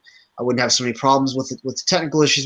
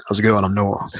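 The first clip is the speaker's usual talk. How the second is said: radio voice; 4 semitones lower than usual. Retold or imitated. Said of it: "Noah's got the best radio voice".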